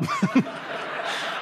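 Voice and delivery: airy burst of laughter; high breathy laugh. Real, not acted. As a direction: let the laugh break out high. Laughing at own story.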